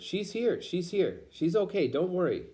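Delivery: high, reassuring call across the street; reassuring shout. Acted. Aunt calling across to the family, high reassuring shout. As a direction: call out to calm them. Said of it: "waved and shouted".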